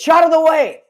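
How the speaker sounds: high, loud call of Out of the way through a laugh; laughing as she voices the old man shooing kids aside; imitating Rena's dad. Imitating Rena's dad laughing, high and loud. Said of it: "used to say".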